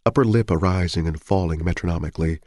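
usual speaking voice

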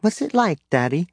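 put-on high, curious little girl. A narrator's reading. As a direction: ask it like an eager small daughter. Child Jaina voiced by narrator.

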